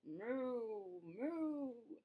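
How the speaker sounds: lightly voiced mooing cow. Narrator voicing the cow in the story, mooing. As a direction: moo gently like the cow. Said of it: "The cow said".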